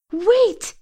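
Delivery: high-pitched mock frantic call; frantic yell. A narrator's reading. You call after Asha as she floats away, frantically. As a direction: plead in a high, urgent cry. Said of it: "you yell frantically".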